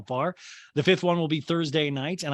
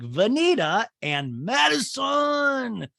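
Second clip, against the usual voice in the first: stretched out, much higher and louder; excited slow shout; 10 semitones higher, 4 dB louder, much slower than usual. Retold or imitated. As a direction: drag the names out like an announcement. Excited announcing party hosts.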